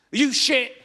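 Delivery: high, loud dad yelling; angry shout. Retold or imitated. Dyslexic dad yelling at a small child, loud. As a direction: snap it at the kid.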